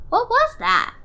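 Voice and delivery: high, loud startled chirp; startled baby bird cry. A narrator's reading. Baby bird Flap startled by the bat, high loud cry. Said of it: "cried Flap".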